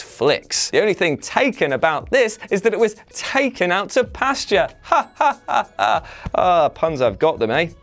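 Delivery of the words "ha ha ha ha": high fake ha ha ha; fake laugh at own pun. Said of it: "At least I make myself laugh".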